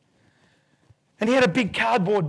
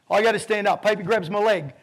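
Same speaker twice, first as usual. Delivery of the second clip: ordinary talk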